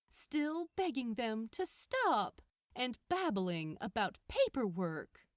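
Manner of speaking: ordinary delivery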